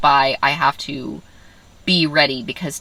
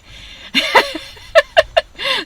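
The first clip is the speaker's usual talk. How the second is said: a high bubbling laugh; a real giggle, high and bright; 4 dB louder than usual. Real, not acted. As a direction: burst out laughing at the absurdity. Laughing at the drug cocktail.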